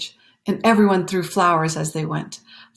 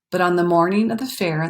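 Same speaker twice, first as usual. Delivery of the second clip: ordinary delivery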